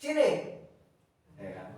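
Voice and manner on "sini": high, loud angry call summoning someone; angry shout. Shown as a demonstration. Teacher demos an angry person calling someone over, furious shout. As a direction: snap at them to come here, furious. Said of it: "Orang yang marah pasti naik".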